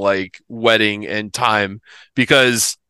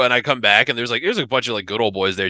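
ordinary talk, nothing marked

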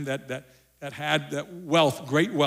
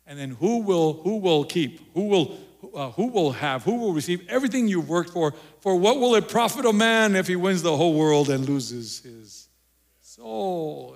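preaching at full force; 3 semitones higher, 6 dB louder than usual. Real, not acted. Rising rhetorical questions in sermon.

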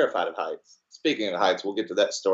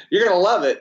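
mock friend; 9 semitones higher, 6 dB louder, faster than usual. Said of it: "he says".

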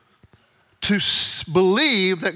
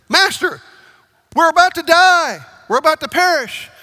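much higher, loud desperate wail; panicked disciples; 9 semitones higher, 8 dB louder than usual. Acted. Disciples begging Jesus in the storm, loud and terrified. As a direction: cry out as if the boat is sinking.

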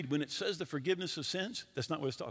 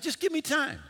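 high, loud begging; acted desperate plea; 11 semitones higher, 5 dB louder than usual. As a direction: imitate a cornered debtor pleading. Debtor pleads while being choked.

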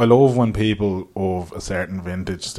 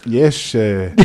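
mock Dutch-accented English; comic Dutch-English interview answer; Steve McLaren Dutch accent. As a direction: hesitate in a put-on Dutch accent. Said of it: "Steve McLaren Dutch".

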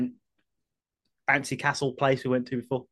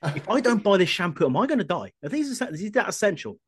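hammed-up worried shopper, higher; mock panicked customer; 7 semitones higher than usual. Pretending to be a panicked shopper asking Stephen, higher. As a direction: demand answers in mock panic.

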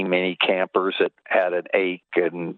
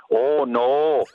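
put-on slow Wisconsin accent, pitch climbing to the end; mock cheeser accent; 6 semitones higher, slower than usual. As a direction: imitate a Wisconsin drive-through worker, drawn out and high. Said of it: "those cheesers".

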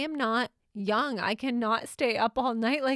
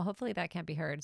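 usual speaking voice

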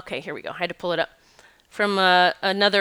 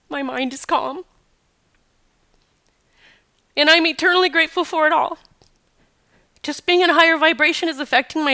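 much higher, tear-choked reading voice; reading through tears; 6 semitones higher than usual. Real, not acted. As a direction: keep reading though crying. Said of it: "Damn it, I'm gonna cry".

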